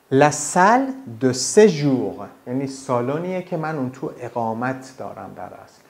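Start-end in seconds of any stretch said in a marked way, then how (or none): 0.1-2.3 La salle de séjour said very slowly and louder, séjour stretched; La salle de séjour spoken very slowly; slow French model. Shown as a demonstration. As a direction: model it slowly for a student to repeat.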